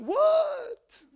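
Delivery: very high, loud startled mother's voice; mom's startled reply. Retold or imitated. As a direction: play the startled mom, very high. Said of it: "She went".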